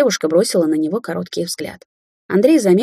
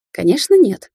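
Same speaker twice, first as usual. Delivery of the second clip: condescending snort; 5 semitones higher, 4 dB louder than usual. A narrator's reading. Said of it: "снисходительно фыркнул Андрей".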